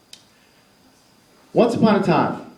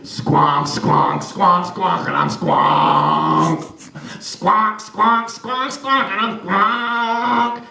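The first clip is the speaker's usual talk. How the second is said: sax squonk; much slower than usual. Acted. Boy plays sax.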